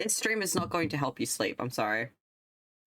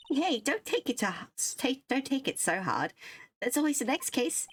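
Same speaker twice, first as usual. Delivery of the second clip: higher, lightly voiced girl consoling; girl voice comforting; 9 semitones higher than usual. Girl sidekick consoling the detective, lightly raised girl voice. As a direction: cheer him up in a raised girl voice.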